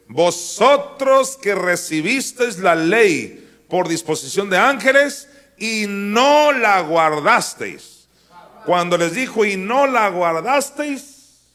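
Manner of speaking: loud, slow, accusing; fiery rebuke. Acted. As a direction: thunder the accusation. Preacher voices Stephen's rebuke.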